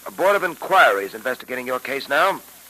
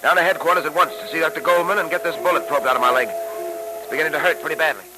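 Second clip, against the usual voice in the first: put-on old radio show. Old-time radio drama episode.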